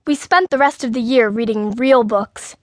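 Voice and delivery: ordinary delivery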